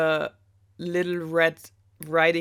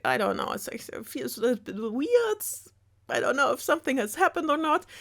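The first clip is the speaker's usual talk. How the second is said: wolf voice; 8 semitones higher than usual. Retold or imitated. Wolf voiced waking up groggy.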